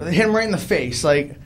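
ordinary delivery

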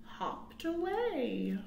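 unvoiced, slow hushed ending; whisper. Shown as a demonstration. Teacher finishing the counting rhyme for children, whispered slowly. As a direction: whisper it as the bunny slips away. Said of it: "rhyme whispered ending".

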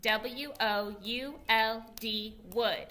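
teacher leading a spelling game with the class; slow game spelling. Shown as a demonstration. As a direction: chant the spelling slowly like a game count. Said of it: "One more time".

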